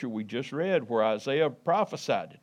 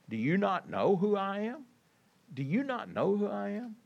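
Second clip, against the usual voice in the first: searching question; 6 semitones higher than usual. Retold or imitated. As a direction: gently press the question, as if quoting Jesus. Preacher voices Jesus questioning Pilate.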